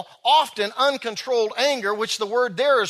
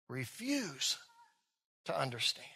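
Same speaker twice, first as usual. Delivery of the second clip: softer, lower echo; quiet repeat; 8 semitones lower, 16 dB quieter than usual. Shown as a demonstration. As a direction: hush it as a reflective echo. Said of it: "They refuse to understand".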